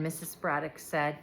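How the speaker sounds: plain reading voice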